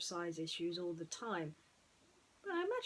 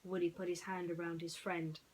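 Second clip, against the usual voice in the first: ordinary delivery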